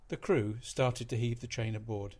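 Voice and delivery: plain reading voice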